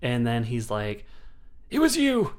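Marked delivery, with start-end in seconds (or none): 1.7-2.3 high, loud hammed-up realization; dramatic accusation. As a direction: belt it out like a soap opera reveal. Ex accusing the intruder.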